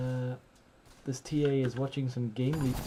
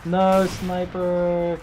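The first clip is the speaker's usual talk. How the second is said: loud drawn-out no; dismayed shout; 5 semitones higher, 10 dB louder, much slower than usual. Real, not acted. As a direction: cry out in dismay. Watching ally miss.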